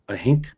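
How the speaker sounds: "think" said as "hink", breath H for the TH; Mid Ulster "hink". Shown as a demonstration. Showing how it was growing up in Mid Ulster, "think" pronounced "hink". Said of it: "H I N K".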